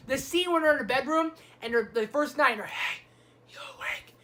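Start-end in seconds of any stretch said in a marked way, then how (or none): 2.7-4.1 soft whisper in the dark; whispered quote. Retold or imitated. Brother asking the other at night, whispered.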